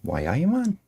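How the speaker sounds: high, playful attempt at Geordie; a quick, slightly hammy Geordie question; Geordie accent. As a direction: try out a Geordie accent, half joking. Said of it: "my attempt at a Geordie accent".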